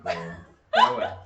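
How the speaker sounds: slow, loud laugh over the words. Real, not acted. Co-host laughing as he cuts the tangent short. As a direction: laugh it off and change topic. Laughing, steering the talk away.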